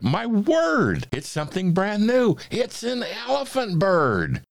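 very high, thrilled crowd cry; shouting. A narrator's reading. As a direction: exclaim it high and loud in amazement. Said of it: "they shouted".

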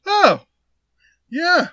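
high, loud, squeaky-polite reply; mock awkward realisation. Retold embarrassed realisation of blunder.

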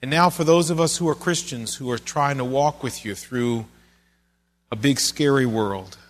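earnest, measured pastor's prayer; closing prayer. Acted. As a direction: pray it humbly for the congregation.

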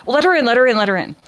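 boss hurrying the door. Acted. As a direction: rush the words, keen to greet her. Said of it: "And the boss goes".

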